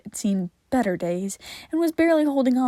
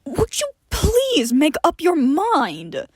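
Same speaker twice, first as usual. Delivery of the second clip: demanding; 6 semitones higher, 3 dB louder, slower than usual. Acted. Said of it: "Johnny demanded".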